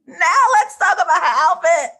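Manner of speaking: gushing, almost crying with excitement; overwhelmed outburst. Real, not acted. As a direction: burst out as if close to tears. Second take, overwhelmed.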